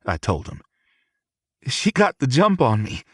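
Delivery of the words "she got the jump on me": lightly voiced cheerful young man. A narrator's reading.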